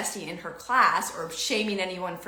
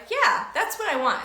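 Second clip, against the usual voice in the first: put-on child's voice; 11 semitones higher, 5 dB louder than usual. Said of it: "And she's like".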